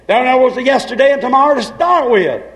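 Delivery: ordinary delivery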